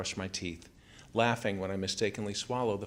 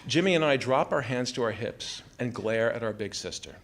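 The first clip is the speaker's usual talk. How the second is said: plain reading voice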